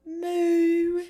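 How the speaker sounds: high, loud moo in a mock British accent; British cow. Co-host imitates a British cow, British accent. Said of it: "a British cow".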